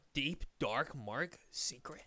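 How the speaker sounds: slow conspiratorial whisper; mock secret whisper. Telling his friend a secret, slow hushed whisper. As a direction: breathe it like a dark secret.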